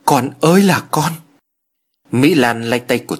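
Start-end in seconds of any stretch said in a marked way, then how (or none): 0.0-1.1 higher-pitched acted mother's lament; narrated distraught motherly cry. A narrator's reading. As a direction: wail lightly for a child. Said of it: "Bà giáo nhân thất thần".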